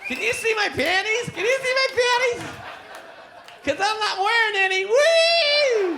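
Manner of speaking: put-on high, loud, laughing drunk; drunk wife. Wife showing off to the bar, high loud drunk voice. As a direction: hoot it at the crowd.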